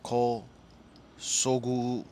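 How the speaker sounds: "Kosogu" in slow, separated syllables; struggling pronunciation. Shown as a demonstration. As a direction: sound out "Kosogu" piece by piece, groping for the name. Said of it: "I'm struggling over here".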